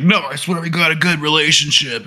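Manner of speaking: high-pitched mock protest; put-on high voice, insisting; pleading husband. Retelling a celebrity's excuse to friends, pleading high mock voice. Acting out [name] pleading.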